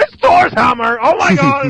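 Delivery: high, loud mock fan squeal; ecstatic comic fan. Comic book fan seeing Thor's hammer, hammed-up ecstatic shriek. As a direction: imitate a fan losing it with excitement, high and loud. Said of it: "the comic book fan is going".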